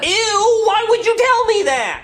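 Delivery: dad hears about tampon, grossed-out shout; disgusted yelling. Acted. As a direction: recoil and yell it.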